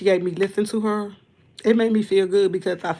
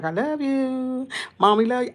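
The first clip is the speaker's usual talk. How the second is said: tender mommy voice; 6 semitones higher than usual. Retold or imitated. Mother telling son she loves him.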